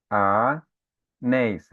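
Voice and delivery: anéis drawn out very slowly, stress on the final néis; last syllable stressed. Shown as a demonstration. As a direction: stretch anéis, leaning on néis. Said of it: "vocês estão percebendo".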